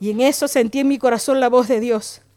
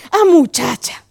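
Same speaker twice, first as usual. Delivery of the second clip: surprised pastor; 4 dB louder, slower than usual. Retold or imitated. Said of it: "Y dice".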